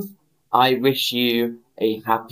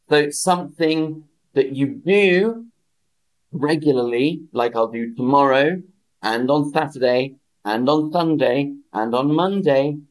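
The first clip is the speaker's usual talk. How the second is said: ordinary delivery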